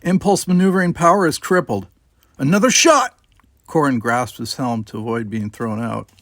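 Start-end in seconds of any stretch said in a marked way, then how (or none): 0.0-1.9 louder, urgent report; raised report. A narrator's reading. Robin calling damage to the captain, urgent raised voice. 2.4-3.2 high sharp warning shout; alarmed shout. A narrator's reading. Crew member warning of incoming fire, alarmed shout. Warning cry during attack.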